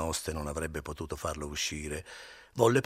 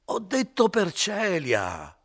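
loud, higher, slowed insistence; shouted; 13 semitones higher, 9 dB louder, much slower than usual. Acted. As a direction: shout it in his face. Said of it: "gridò questo sul viso di Renzo".

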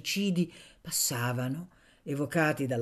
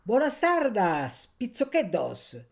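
slow, higher Sardinian street call; Sardinian dialect greeting; 5 semitones higher, 3 dB louder, slower than usual. A narrator's reading. Night woman greets two passing young men, Sardinian dialect call. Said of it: "li salutò in dialetto sardo".

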